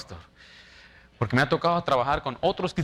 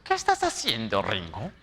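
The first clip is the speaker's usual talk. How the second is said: mock nagging boss; 5 semitones lower than usual. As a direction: nag like the boss lady. Supervisor checking on him again.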